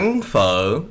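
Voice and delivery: "Ăn phở" as ang fuh, with dipping-rising tone; Southern Vietnamese. Shown as a demonstration. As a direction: tonal demonstration of "Ăn phở". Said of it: "ultimate Southern Vietnamese accent".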